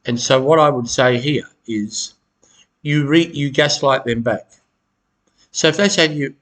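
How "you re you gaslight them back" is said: ordinary talk, nothing marked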